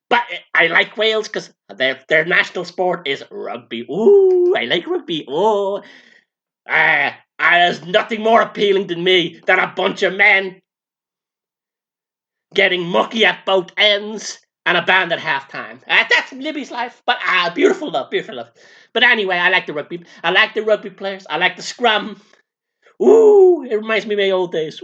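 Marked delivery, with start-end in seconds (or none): 3.9-4.5 high, loud delighted ooh. Acted. Libby reacting to Wales's national sport rugby, delighted high ooh. 23.0-23.7 very high, loud ooh; delighted "ooh". Acted. Libby thinking of the rugby scrum, excited high ooh.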